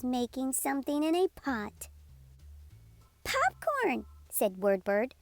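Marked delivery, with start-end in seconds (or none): 3.2-4.1 much higher, happy discovery; delighted popcorn. Acted. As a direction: say it with glee. Word Bird delighted.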